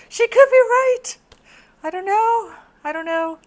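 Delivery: high, loud amazed outburst; excited exclamation. Real, not acted. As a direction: burst out in amazed realisation.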